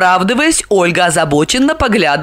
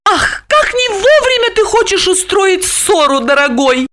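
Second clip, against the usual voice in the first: much higher, dismissive airy brush-off; 13 semitones higher than usual. A narrator's reading. Olga brushing off her husband's quarrel, dismissive. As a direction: wave the quarrel away. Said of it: "отмахнулась Ольга".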